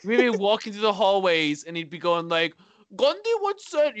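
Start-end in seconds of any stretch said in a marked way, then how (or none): 3.0-4.0 high-pitched mimicked Indian accent; mock Indian accent. Recalling his friend in school hallways, mock Indian accent. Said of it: "He started doing that".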